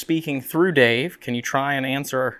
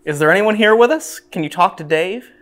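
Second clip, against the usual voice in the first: raised voice, high and loud; calling out; 5 semitones higher, 8 dB louder than usual. Real, not acted. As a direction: call out to the empty room. Calling out to spirits.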